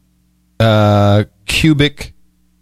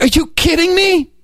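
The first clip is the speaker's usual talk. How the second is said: disbelief. Real, not acted. Outraged at phone charges.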